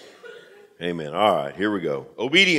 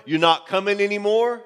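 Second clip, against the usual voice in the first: ordinary talk, nothing marked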